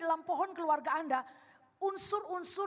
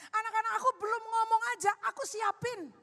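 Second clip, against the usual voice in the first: higher, emphatic insistence; aggrieved self-defence; 6 semitones higher, 4 dB louder than usual. Acted. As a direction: plead your case as a misunderstood father. Acts out father defending his love. (membela diri dengan nada tinggi)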